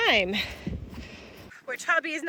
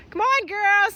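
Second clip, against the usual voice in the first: higher sing-song call; calling the cows; 7 semitones higher than usual. Real, not acted. Farmer urging her heifers up the hill, high call. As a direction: lift it up to reach the herd.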